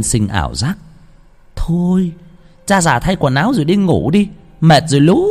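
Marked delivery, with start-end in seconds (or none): 1.6-5.3 higher nagging wife voice; weary wife telling husband to go to bed; grumbling old wife. Acted. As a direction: scold him like a tired old wife.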